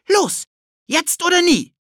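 put-on very high, loud boy's cry; excited urging. Ted urges Philipp on, very high loud boy cry. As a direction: burst out now or never.

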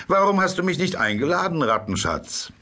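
teasing otter. A narrator's reading. Otter teases the rat.

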